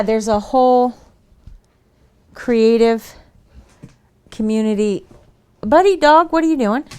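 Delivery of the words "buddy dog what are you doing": calling the dog. Real, not acted.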